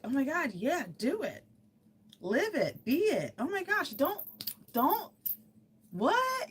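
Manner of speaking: high-pitched hype-friend squeals; gushing encouragement. Acted. Saying to yourself what a best friend would, gushing. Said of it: "You have to say to yourself".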